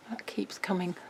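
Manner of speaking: breathy aside under the breath; whisper. Real, not acted. Host whispering to herself mid-demo, hushed. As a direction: murmur it to yourself. Muttering while handling the album.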